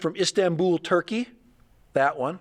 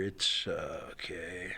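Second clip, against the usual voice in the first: whispering; 10 semitones lower, 10 dB quieter, slower than usual. Real, not acted. Muttering while searching online.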